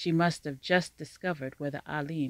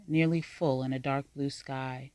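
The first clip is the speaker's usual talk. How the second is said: plain reading voice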